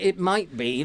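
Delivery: normal talking voice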